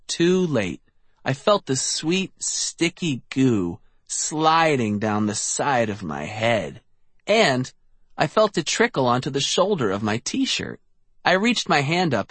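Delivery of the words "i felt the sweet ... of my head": plain reading voice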